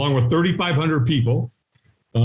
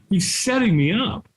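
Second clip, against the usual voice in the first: ordinary talk, nothing marked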